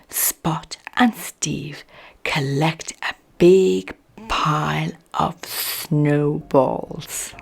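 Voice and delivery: ordinary delivery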